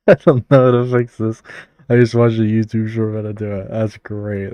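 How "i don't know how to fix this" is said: loud laugh into the words. Real, not acted. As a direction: laugh while admitting defeat. Laughing at chat's problem.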